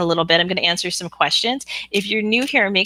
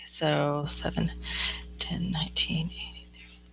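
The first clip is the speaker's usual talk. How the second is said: calculating numbers under her breath, whispered; whispering to herself; 12 dB quieter, slower than usual. Real, not acted. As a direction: whisper the sums privately as you count.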